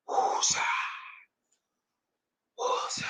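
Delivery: long unvoiced "woosah" breath; calming exhale. Real, not acted. Host settling himself after a rant, calming exhale. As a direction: let the tension out in a soft breath. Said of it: "calm down, [name]".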